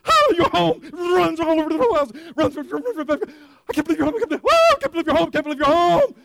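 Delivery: high, loud, frantic excitement; overjoyed dog. Acted. Dog greeting its owner at the door, wildly excited. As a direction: shout it in breathless, racing bursts of joy.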